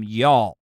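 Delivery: y'all dropped low and drawn; low Southern y'all in disbelief; low drawled y'all. Shown as a demonstration. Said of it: "where the hell that came from".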